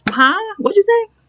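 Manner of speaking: high, fake-puzzled What you say?; feigning not hearing. To someone with an accent, feigning not hearing, high and coy. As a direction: pretend you missed it so they repeat. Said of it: "you act like you don't know what they saying".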